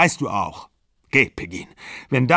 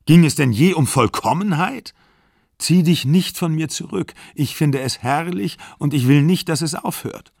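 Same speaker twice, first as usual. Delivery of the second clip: higher, louder, pleading rage; anguished angry plea; 6 semitones higher, 4 dB louder than usual. A narrator's reading. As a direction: beg her to stay, furious. Exler pleads and rages.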